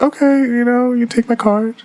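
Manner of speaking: very high, airy mom imitation; mother's offer. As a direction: put on a high voice as mom handing it over.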